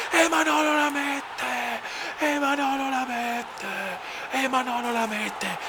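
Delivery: high, slow stretched commentator cry; Italian goal commentary. Acted. Mock commentator goal call.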